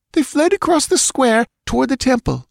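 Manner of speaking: put-on high, anxious girl voice; frightened girl. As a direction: answer breathlessly. Ivga answering.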